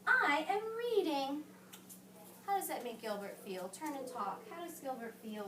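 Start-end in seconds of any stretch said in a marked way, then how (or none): 0.1-1.4 higher, slow busy farmer; the farmer brushes the pig off; annoyed farmer. Acted. Storybook farmer shushes pig, higher and slower. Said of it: "said the farmer".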